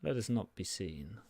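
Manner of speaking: quiet, slow incantation; a hushed thief casting an invisibility spell; Torvil's spell words. Acted. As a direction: murmur the spell softly and slowly. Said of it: "and kind of says".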